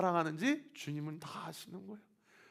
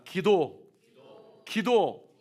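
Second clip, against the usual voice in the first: call to repeat; 3 semitones higher, 7 dB louder, much slower than usual. Shown as a demonstration. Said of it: "따라합시다".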